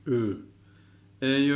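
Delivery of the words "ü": "Ü" as a clear rounded ü, lips forward; ü sound. Shown as a demonstration. Teacher modelling "Ü" with rounded lips. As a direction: model the "Ü" slowly for students. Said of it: "ötreli olduğu zaman ü diye okuyoruz".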